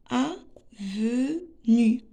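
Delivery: avenue very slowly, a-ve-nue, with gaps; teacher sounding out avenue piece by piece; split into syllables. Shown as a demonstration. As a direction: break avenue into three separate syllables. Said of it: "on va découper les mots en syllabes".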